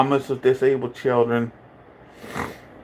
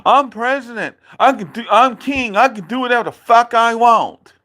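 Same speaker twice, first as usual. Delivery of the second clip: mocking dad's boast; 7 semitones higher than usual. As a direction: ham up the drunk dad's boast, pitched high. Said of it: "You said".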